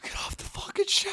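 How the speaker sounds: whispered mock dad line; whispered quote. Friend whispering the dad's shed line, mock whisper. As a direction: breathe the yell as a whisper.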